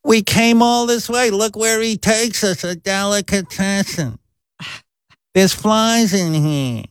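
slow, high kvetching mom; complaining mother. Retold or imitated. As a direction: whine it like a disappointed mother. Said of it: "my mother would start cackling and going".

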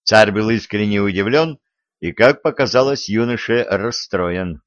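usual speaking voice

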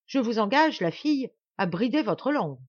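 high, loud, menacing reprimand; angry warning. Acted. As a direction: warn her off angrily. Duke threatens the insolent girl. (avertissement menaçant et irrité)

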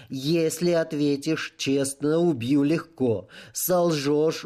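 slow, each word crisp and separate; enunciating every word. Acted. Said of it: "чётко выговаривая каждое слово".

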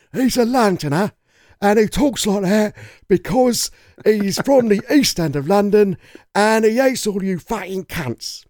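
high, drawn-out Cockney villain impression; Bricktop. Explaining where Bricktop is from, in an exaggerated Cockney accent. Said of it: "Bricktop is from, uh, he's from London".

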